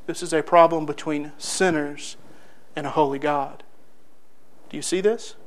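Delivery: very soft, low and grave; hushed preaching. Real, not acted. Preacher telling listeners we do the same, hushed. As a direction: drop the voice so they lean in.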